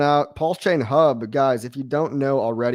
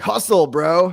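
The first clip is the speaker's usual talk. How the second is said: a louder, higher mock pep talk; hammed-up hustle coach; hustle guru; 5 semitones higher, 7 dB louder, slower than usual. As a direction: bark it like a motivational guru. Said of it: "he's gonna be like".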